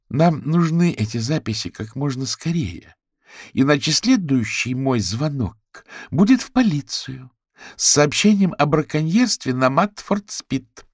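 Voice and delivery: high, loud stern threat, slowing at the end; tough ultimatum. A narrator's reading. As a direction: lay down the law over the phone. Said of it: "Фиона ненавидела применять жёсткие меры".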